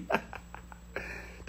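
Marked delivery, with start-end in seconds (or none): 0.0-0.1 laughing. Real, not acted. Laughing at being ghosted.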